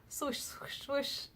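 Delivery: soft breathy swooshing heartbeat noise, high and faint; half-hearted whispered heartbeat imitation; imitated heartbeat swoosh. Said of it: "that was a bad impression".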